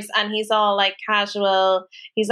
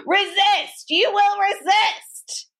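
high, slow shouted command; military commands; 10 semitones higher, much slower than usual. Retold or imitated. Dog trainer ordering the dog, hammed-up army shout. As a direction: bark it out like a drill instructor. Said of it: "American military terms".

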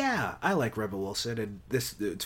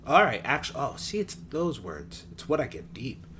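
ordinary talk

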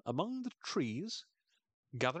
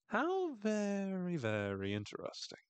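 very slow and much higher, arch; sarcastic drawn-out; 9 semitones higher than usual. Real, not acted. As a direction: stretch it out with dry sarcasm. Sarcastic comment on headdress.

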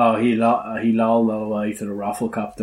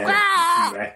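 dinosaur roar. Acted. T-Rex screaming in Starbucks.

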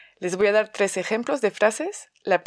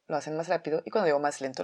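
speeding up; much faster than usual. Shown as a demonstration. Teacher showing how players speed up, fast. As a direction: rattle it off fast, as if acting out speed. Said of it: "cada vez que digo más rápido".

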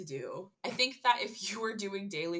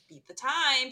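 a bright, louder mock announcer call; louder, higher mock instruction; mock game command; 6 semitones higher, 9 dB louder, slower than usual. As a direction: bark it like a game prompt. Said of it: "and they're like".

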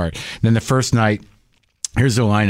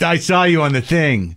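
slow high mock fan voice; 7 semitones higher than usual. Comic mocking TV-focused fans, slow high. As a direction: say it like a vague fan. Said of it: "they won't refer to your act like".

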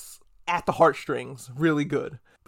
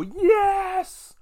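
a squealed, much higher "Yes!"; ecstatic yes; 3 dB louder than usual. Retold or imitated. Bigfoot enthusiast finally sees the feet, with an ecstatic high squeal. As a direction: burst with fan joy. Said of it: "see a man look at feet and then go".

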